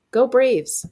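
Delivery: loud, high cheer. Acted. Sean cheering for the high school team. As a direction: shout it for the team.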